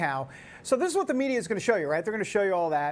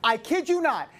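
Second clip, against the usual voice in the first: ordinary talk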